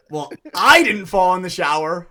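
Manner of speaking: "I" punched high and loud; loud, pointed emphasis on "I"; stress on I. Retold or imitated. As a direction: hit the word "I" hard, then drop down. Retold answer hinting who fell.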